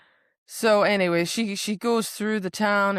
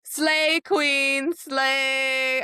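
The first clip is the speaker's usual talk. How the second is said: high, drawn-out whoop of approval; hammed-up cheering; 8 semitones higher, 4 dB louder, much slower than usual. Reader cheering defiant heroine, high drawn-out cheer. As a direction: draw each word out high like a fan.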